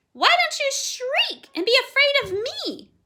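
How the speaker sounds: high, loud squawking bird; parrot voice. A narrator's reading. Parrot asking the girl why she isn't scared, squawking.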